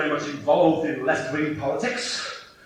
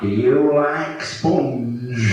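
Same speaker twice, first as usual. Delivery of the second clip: low, very slow creepy question; weird voice; 4 semitones lower, much slower than usual. Retold or imitated. Bus-station nutcase asking about sponge, very slow and low. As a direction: drawl it low and odd. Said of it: "It goes".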